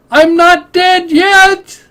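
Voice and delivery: high-pitched, loud comic impression; Monty Python and the Holy Grail quote. Player reciting a Monty Python line, high comic cry. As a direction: cry it out slow and high, hammed up. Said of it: "Monty Python and the Holy Grail".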